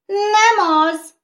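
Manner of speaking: high, loud shouts; shouting crowd. Acted. Skittles clamouring against each other, shouting. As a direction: shout together. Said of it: "kiáltottak egyre többen".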